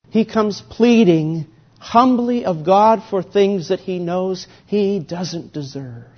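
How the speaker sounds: normal talking voice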